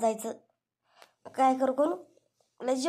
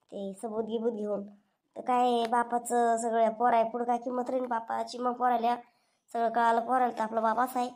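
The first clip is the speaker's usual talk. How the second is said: normal talking voice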